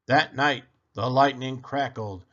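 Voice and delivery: ordinary delivery, nothing marked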